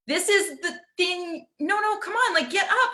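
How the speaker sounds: put-on high, pleading parent urging the kid up; coaxing parent. Parents begging the kid not to miss the big reveal. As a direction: cajole a child who won't look.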